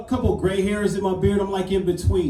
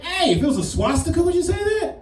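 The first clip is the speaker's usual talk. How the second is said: indignant retort; 10 semitones higher than usual. Retold or imitated. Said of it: "It's like".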